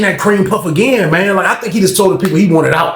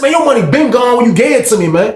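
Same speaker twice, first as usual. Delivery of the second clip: high-pitched dismissive comeback; scornful retort; 7 semitones higher than usual. Retold or imitated. Storyteller telling the man his money is gone, scornful. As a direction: throw it back at him in a raised voice. Acted quarrel over the money.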